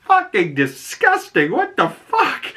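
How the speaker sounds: high, squealing imitated outrage; mock disgust. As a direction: act grossed out and shocked. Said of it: "she's, like".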